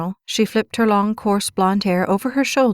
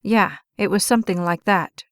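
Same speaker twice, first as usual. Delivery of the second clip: plain reading voice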